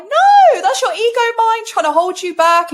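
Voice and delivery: loud high "No!" then insistent; emphatic no. Retold or imitated. Telling a client it's the ego, a loud emphatic no. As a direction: shut the doubt down hard. Answering client, firm correction.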